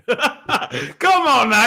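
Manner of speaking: laughing hard through the words; cracking up, barely getting it out. Real, not acted. Host laughing at the caller's story.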